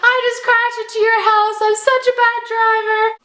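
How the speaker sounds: high-pitched, wailing mock outburst; hammed-up distraught yell; hysterical yelling. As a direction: re-enact a tearful meltdown, way up high. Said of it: "then I start kind of yelling".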